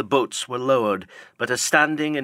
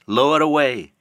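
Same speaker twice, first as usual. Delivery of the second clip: cried command; 4 semitones higher, 6 dB louder than usual. Acted. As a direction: bark it down to the crew. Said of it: "cried Ahab".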